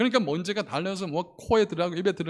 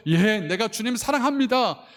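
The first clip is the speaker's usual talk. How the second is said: 고백; 8 semitones higher, 5 dB louder than usual. Retold or imitated. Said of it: "고백을 했어요".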